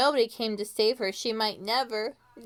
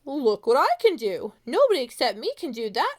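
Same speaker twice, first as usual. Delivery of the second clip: proud showing off; 3 semitones higher, 6 dB louder than usual. Acted. Pretzel shows off to Greta.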